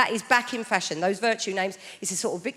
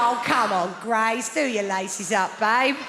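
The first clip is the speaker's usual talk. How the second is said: mock exasperated parent; slower than usual. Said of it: "You'd be like".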